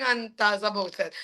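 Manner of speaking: usual speaking voice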